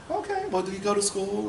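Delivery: high-pitched imitation of a questioning mom; mom interrogation. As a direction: quiz him way up high. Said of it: "the classic, like, mom breakdown".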